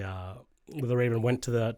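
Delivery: normal talking voice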